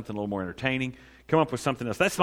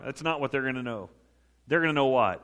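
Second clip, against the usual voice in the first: usual speaking voice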